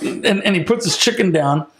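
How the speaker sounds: usual speaking voice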